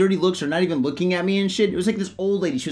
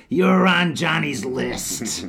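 slow mock threat; slow menacing; much slower than usual. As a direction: drag it out like a threat.

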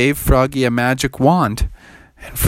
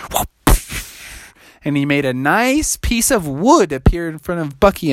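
ordinary delivery, nothing marked

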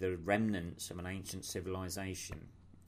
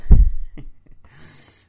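loud burst of stifled laughter; trying not to laugh; 25 dB louder than usual. Real, not acted. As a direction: laugh breaking out despite himself. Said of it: "Sorry, I'm trying not to laugh".

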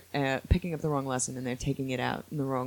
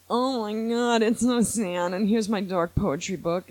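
higher-pitched mock whining; hammed-up mopey complaint; whiny Kylo Ren; 6 semitones higher than usual. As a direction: imitate a self-pitying whiner. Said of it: "so whiny".

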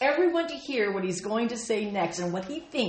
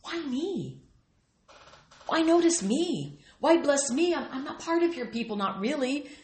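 pleading Ruth; 6 semitones higher than usual. Acted. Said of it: "Her question".

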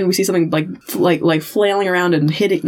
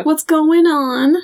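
slow, high, mock-sweet cooing; syrupy coaxing; 8 semitones higher, slower than usual. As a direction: imitate a director humouring an actress. Director sweet-talks upset actress.

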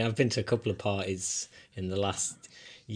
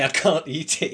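laughing while speaking, louder and higher; 6 semitones higher, 7 dB louder than usual. Real, not acted. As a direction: laugh through the words. Laughing at own sensitivity.

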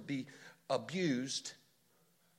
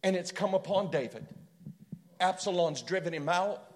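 rising, louder and higher, driving the story forward; preaching at full force; 4 semitones higher, 8 dB louder than usual. Acted. Preacher building to a peak telling of David's flight.

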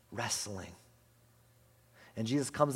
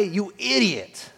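mock insult; 6 semitones higher, 5 dB louder, much slower than usual. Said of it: "Jesus doesn't say".

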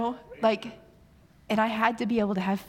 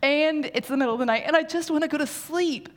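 mock complaint; 5 semitones higher, faster than usual. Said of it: "And I'm like".

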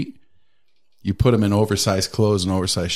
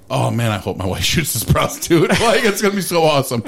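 laughing joke; 5 semitones higher than usual. Retold or imitated. Joking about wife shooting her.

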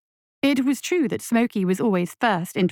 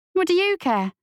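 put-on higher young boy, impatient; irritated boy; 9 semitones higher than usual. A narrator's reading. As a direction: brush it off like a cocky kid. Lasky voiced as boy.

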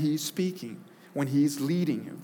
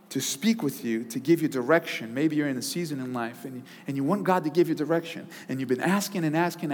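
normal talking voice

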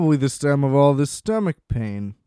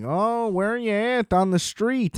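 high, slow mock Chicago accent; Chicago caller impression; 8 semitones higher than usual. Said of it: "the most Chicago guy of all time".

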